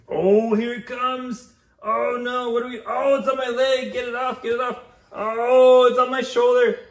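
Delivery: high squealing fake fright over a spider; mock panic. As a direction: shriek like the spider is crawling on you. Tickle spider game, mock fright.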